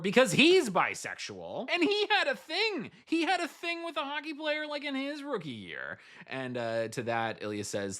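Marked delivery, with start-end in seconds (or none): 0.0-6.0 high-pitched, giddy squeal; excited reveal. Real, not acted. Recapper revealing the coach's surprise backstory to viewers, giddy and high. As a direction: spill the twist in a very high, excited voice.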